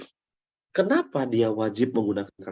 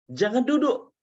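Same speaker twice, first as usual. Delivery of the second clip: telling someone not to sit; 10 semitones higher than usual. Shown as a demonstration. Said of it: "Boleh komen nggak?".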